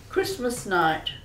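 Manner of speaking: ordinary talk, nothing marked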